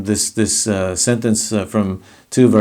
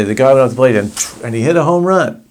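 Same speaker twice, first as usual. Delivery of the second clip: acted out, excited; 3 semitones higher, 3 dB louder, faster than usual. Shown as a demonstration. Teacher acting out a baseball home run with excitement. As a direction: tell it like an exciting play-by-play. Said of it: "act out scenes of what you're saying".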